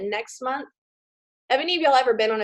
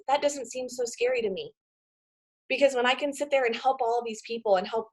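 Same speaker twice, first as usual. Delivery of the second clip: normal talking voice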